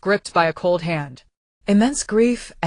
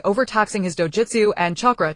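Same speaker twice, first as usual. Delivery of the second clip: ordinary delivery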